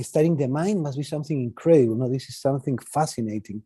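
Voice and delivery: ordinary talk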